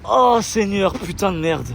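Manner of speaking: high and loud burst of relief; relieved exclamation. Real, not acted. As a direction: cry out in relieved joy. Relief on arrival. (cri de soulagement)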